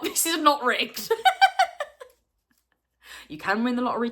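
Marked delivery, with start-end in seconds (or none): 0.0-1.1 protesting through laughter; laughing. Real, not acted. Vlogger laughing while insisting the draw is fair. 1.2-2.0 high squealing laugh. Real, not acted. Vlogger cracking up over a rigged draw.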